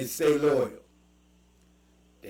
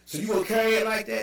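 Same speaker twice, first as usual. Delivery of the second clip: quoting locals; 8 semitones higher, faster than usual. Retold or imitated. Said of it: "They're like".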